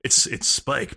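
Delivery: ordinary delivery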